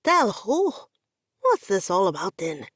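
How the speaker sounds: a lightly voiced nervous IT guy; a hint of a puzzled office nerd asking; lightly voiced coworker, confused; Pavios voiced.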